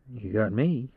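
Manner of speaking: plain reading voice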